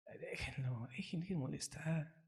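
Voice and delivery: susurrado y muy suave; imítalo en voz baja casi secreta; susurro imitado. Retold or imitated. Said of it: "le decían". (dicen al rey David que lo deje, susurrado)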